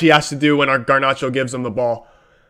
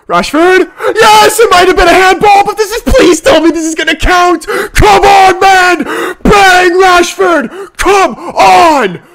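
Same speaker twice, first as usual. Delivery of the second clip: much higher and louder screaming; real shout; 13 semitones higher, 6 dB louder than usual. Real, not acted. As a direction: scream with total joy. Fan celebrating a goal.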